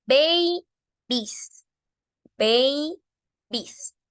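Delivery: babies very slowly, split "bey - bees"; pronunciación modelo. Shown as a demonstration. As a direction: stretch babies into two syllables, silent E before the S. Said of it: "no se pronuncia y sigue con una S". (babies muy lento, por sílabas, "bei - bis")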